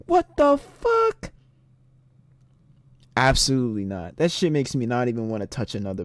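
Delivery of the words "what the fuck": high, drawn-out shocked question; slow incredulous squeal, rising at the end; re-enacted disbelief. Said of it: "I'm sitting there like".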